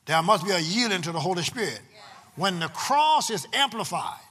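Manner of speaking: loud, emphatic preacher with long-held key words; full-force preaching. Real, not acted. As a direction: preach it to the congregation in bursts, punching the key words.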